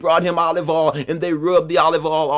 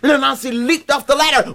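ordinary delivery

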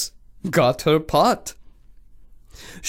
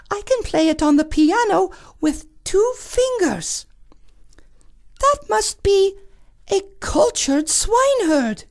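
high whispery princess; airy high princess voice; cartoon princess. Princess voice continues.

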